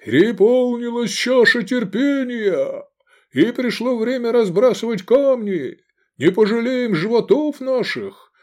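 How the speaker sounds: high-pitched rousing sermon; lofty, high preaching voice; preacher rousing flock. A narrator's reading. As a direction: proclaim it to inspire the faithful to battle. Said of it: "Сикст Первый вещал, вдохновляя паство на бой".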